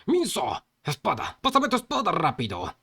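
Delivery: high, urgent acted shout; urgent battle yell; shouted demand. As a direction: yell for the sword in a hurry. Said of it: "le gritó al gordo inútil".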